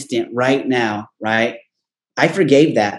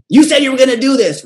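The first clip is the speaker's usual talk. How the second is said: higher, louder scolding mom; 9 semitones higher, 4 dB louder than usual. Retold or imitated. Guest voices his mother scolding him, higher and louder.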